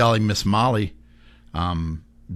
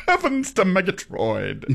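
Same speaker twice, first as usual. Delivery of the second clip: high, slow cartoon-character exclamation; 9 semitones higher than usual. Showing the host how the old cartoon character talked, high and slow. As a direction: play the old cartoon lion, drawn out and high. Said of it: "he would talk like this".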